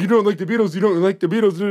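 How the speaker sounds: high, loud disbelieving repeat; hammed-up shocked chorus; mock stunned staff. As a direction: staff aghast at the news. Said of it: "They're like".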